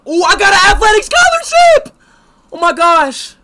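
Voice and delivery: high, very loud joyful yell; ecstatic shout. Real, not acted. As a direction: scream the good news. Gamer wins scholarship.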